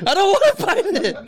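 high, laughing protest. Real, not acted. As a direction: laugh through the words. Laughing while refusing to bite.

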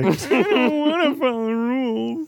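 high, slow bratty whine; mocking whiny voice. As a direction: drag it out like a sulky kid. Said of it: "That was my impersonation of you".

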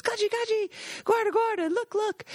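high, eager calls across the woods; excited calling out. Retold or imitated. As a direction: call him over like you just struck gold. Excitedly calling guide over in woods.

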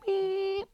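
very high, steady electronic beep; imitated censor beep. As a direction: squeal out a single thin test tone. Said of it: "it's like".

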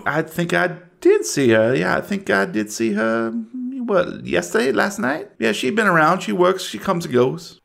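lightly voiced self-assured deputy; a local deputy angling for promotion; a hint of small-town lawman; folksy deputy. Game master voices local deputy.

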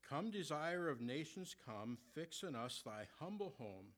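slow, measured reading of hymn verses. Acted. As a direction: read the old hymn lines with reverence. Reads hymn verses aloud.

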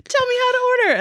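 high laughing imitation of herself; laughing high retelling. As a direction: giggle through your own clueless question.

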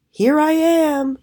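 high, drawn-out hatchling's crow; proud crowing. A narrator's reading. Newborn raven announces himself to nobody, crowing proudly. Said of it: "he crowed".

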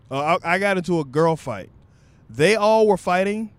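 usual speaking voice

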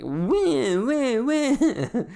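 loud, high crybaby wail; mock crying. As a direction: bawl mockingly like a baby. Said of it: "make the face of somebody who's, like, crying".

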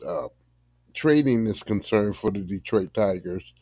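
ordinary delivery

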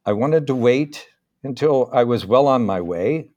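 ordinary delivery, nothing marked

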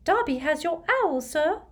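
high-pitched squeaky house-elf; little elf's high voice. A narrator's reading. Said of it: "said a squeaky voice".

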